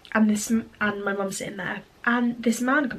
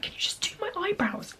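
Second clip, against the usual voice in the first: mock pleading, high and soft; a small, high-pitched retold plea, mildly fed up; exasperated plea; 11 semitones higher, 8 dB quieter than usual.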